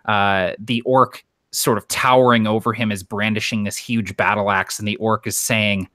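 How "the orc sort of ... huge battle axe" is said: normal talking voice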